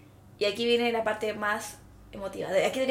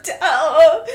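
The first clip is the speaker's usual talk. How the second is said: louder, laughing through tears; tearful goodbye; 6 semitones higher, 15 dB louder than usual. Retold or imitated. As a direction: sob out the farewell. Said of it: "tearful airport goodbye".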